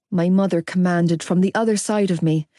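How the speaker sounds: ordinary delivery, nothing marked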